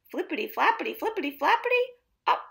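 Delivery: rattled off fast and bouncy; fast flapping rhyme. A narrator's reading. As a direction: flap through it quickly. Hen flapping, sing-song.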